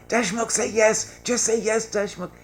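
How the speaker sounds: high, loud crowd yelling to push him; egging him on at full shout. Retold or imitated. The room yells at Deshmukh to accept, loud high shout. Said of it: "So they all started yelling".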